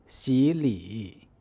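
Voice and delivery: 洗礼 read with 洗 as a second tone; third tone sandhi. Shown as a demonstration. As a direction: raise the first syllable of 洗礼. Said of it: "the first syllable changes to the second tone".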